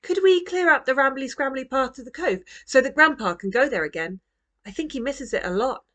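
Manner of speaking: put-on a little girl begging with her plan; eager child. A narrator's reading. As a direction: Roo pitching her idea to her parents, eager and pleading. Roo pitches her plan.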